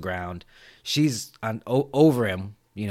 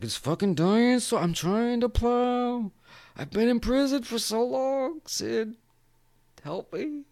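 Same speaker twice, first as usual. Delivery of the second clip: mock pleading; 12 semitones higher than usual. Acting out Cotton begging Sid for help, pleading. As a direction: plead in a put-on high voice.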